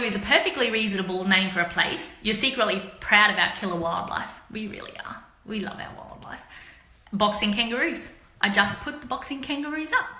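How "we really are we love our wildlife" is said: soft aside. Real, not acted. Proud aside about wildlife.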